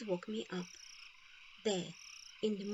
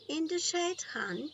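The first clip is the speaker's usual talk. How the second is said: lightly voiced Grandma, higher and louder; a kindly grandmother answering, lifted up; Grandma's voice; 8 semitones higher, 4 dB louder than usual. Grandma voiced in read story.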